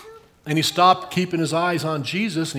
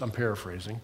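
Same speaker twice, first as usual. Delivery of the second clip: ordinary delivery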